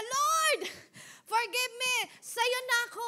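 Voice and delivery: high, loud pleading "Lord, forgive me"; mock repentant plea. Preacher mocks churchgoers who beg the Lord, hammy pleading. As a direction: ham up a showy sorry-to-God plea. Mocking insincere repentance prayer.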